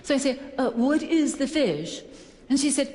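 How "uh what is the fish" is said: higher, drawn-out haughty question; mock-superior, stretched-out delivery; acting snobby. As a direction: ask it like a condescending snob, very slowly. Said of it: "trying to humiliate the waitress into saying".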